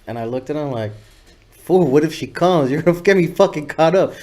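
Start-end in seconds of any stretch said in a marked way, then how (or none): 1.6-4.1 louder, higher mock panic; half-joking alarm at getting caught; retold protest. Retelling how he balked at Toker's offer, louder and higher.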